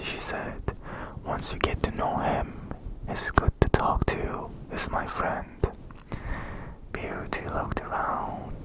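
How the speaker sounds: plain reading voice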